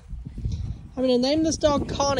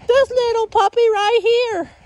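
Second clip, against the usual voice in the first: puppy talk; 10 semitones higher, 6 dB louder than usual. Real, not acted. Owner greeting a coned puppy, high baby talk. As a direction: coo at the little puppy.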